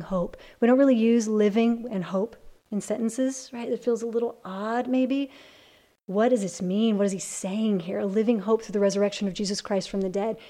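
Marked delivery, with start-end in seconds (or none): none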